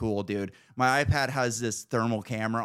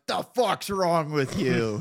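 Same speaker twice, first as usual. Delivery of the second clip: higher, drawn-out indignant retort; mock outraged bartender; 6 semitones higher, 4 dB louder, slower than usual. Bartender to a customer asking for Bud Light, mock outrage. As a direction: imitate an affronted bartender, slow and raised in pitch. Retold bartender's offended reply.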